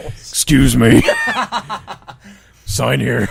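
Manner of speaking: laughing through a mock courier line; laughing delivery-man bit. Dragon courier asking for a signature, laughing. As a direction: play the dragon courier while cracking up.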